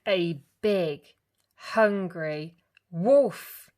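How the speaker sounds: big slow storyteller boom; dramatic build. A narrator's reading. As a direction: lean hard into the big bad wolf. Dramatic narration.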